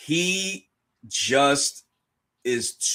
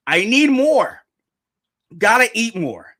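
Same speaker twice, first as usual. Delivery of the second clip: hungry Luffy; 6 semitones higher, 6 dB louder than usual. Retold or imitated. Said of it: "he's talking in, uh, foodieese".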